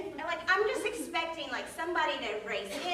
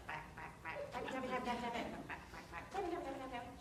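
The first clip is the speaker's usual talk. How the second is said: mock typing noises; 4 semitones lower, 14 dB quieter, faster than usual. Mimicking clerk typing noises.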